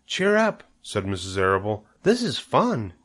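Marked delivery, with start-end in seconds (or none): 0.1-0.6 much higher, cheerful lilt; cheery encouragement. A narrator's reading. Woman to her scared companion, cheery. 2.0-2.9 high, swooping cheerful voice; cheery delight. A narrator's reading. Woman to her scared companion, cheery.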